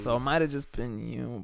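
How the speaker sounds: ordinary delivery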